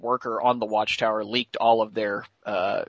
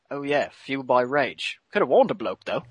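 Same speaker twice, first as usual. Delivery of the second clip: put-on Australian accent; 2 semitones higher than usual. Boomer speaks again, same accent.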